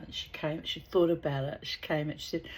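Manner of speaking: usual speaking voice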